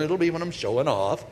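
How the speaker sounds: ordinary talk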